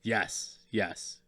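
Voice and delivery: ordinary talk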